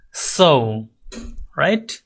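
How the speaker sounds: ordinary talk, nothing marked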